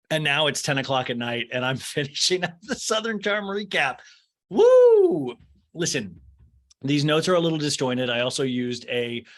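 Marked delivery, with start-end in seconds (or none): 4.5-5.3 a high, loud whoop, far above her own pitch; excited whoop. Real, not acted. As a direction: cheer as if the finish line is in sight. Excited cheer at late-night work.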